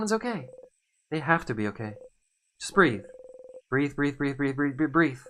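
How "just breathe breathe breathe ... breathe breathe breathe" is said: raised repeated urging; anxious self-soothing. Acted. Hero urging self to breathe after panic. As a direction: coax yourself to breathe.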